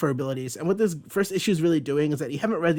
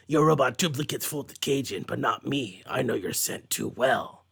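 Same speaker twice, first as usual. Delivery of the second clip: Wolverine smugly sniffing out robots; 2 semitones lower than usual. Retold or imitated. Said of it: "it's doing stupid accents".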